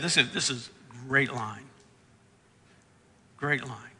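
usual speaking voice

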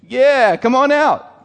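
sly ghost luring Peter out; mock ghost. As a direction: taunt him out onto the water.

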